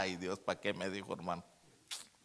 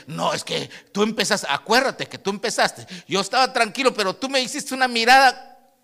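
mock quarrel, building louder and higher; acted accusation; 9 semitones higher, 12 dB louder than usual. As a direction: hurl the blame back, rising to a shout. Voices one side of marital quarrel. (reproche actuado que sube hasta el grito)

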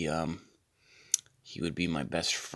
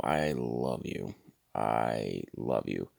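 lower, slow mock-tender confession; 7 semitones lower, 4 dB quieter, slower than usual. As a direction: sigh it out like a smitten fan. Confessing love to assistant.

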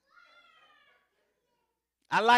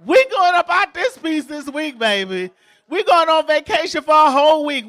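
excited reply; 4 semitones higher, 7 dB louder than usual. Retold or imitated. Acted dialogue, excited vacation reply.

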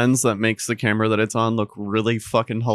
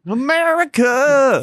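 mock patriotic cheer; 13 semitones higher, 3 dB louder, slower than usual.

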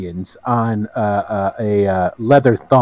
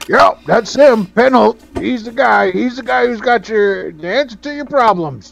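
higher-pitched sidekick vouching for his man; eager sidekick; 8 semitones higher than usual. Acted. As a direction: talk him up in a raised voice. Game master plays Penholt's promoter.

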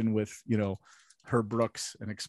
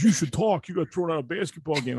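mock coach; 7 semitones higher, 3 dB louder than usual. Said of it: "He goes".

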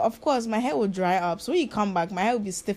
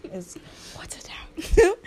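whisper; 8 semitones higher, slower than usual. Real, not acted. Whispered aside about the food.

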